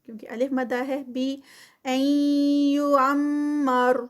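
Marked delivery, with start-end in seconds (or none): 1.8-4.0 slow, drawn-out tajweed recitation; Quranic recitation. Shown as a demonstration. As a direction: chant it slowly, stretching each sound. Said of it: "این پہ بھی نون ساکنہ کا role". (آہستہ کھینچ کر تلاوت)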